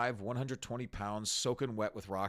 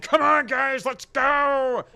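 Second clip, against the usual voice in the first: high, loud call to charge; rallying shout; 13 semitones higher, 13 dB louder, slower than usual. Retold or imitated. Guy in front rallying the protesters, loud, high shout. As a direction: fire up the crowd like a ringleader. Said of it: "He's like".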